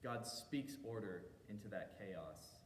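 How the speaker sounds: normal talking voice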